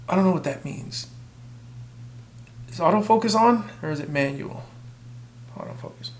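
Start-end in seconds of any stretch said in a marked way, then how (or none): 5.6-6.1 a quick whispered aside. Real, not acted. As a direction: whisper the answer off-mic. Whispered aside to camera crew.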